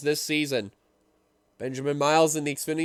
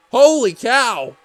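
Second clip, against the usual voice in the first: amazed; 6 semitones higher, 9 dB louder, slower than usual. Real, not acted. Finish line reaction.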